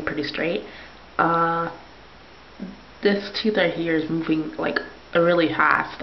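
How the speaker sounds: talking with lip held down; showing braces while speaking. Real, not acted. Seen 2: Finger holds lower lip down, showing braces, throughout the words.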